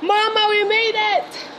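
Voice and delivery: high, loud cheering at the top of the stairs; excited cheering. Real, not acted. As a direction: whoop with relief, you finally made it.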